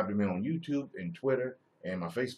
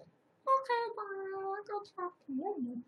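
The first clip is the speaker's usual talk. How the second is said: put-on much higher, soft child voice; little girl; 5 dB quieter than usual. Daughter agreeing to go talk to her mother, sweet. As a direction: answer sweetly like a toddler.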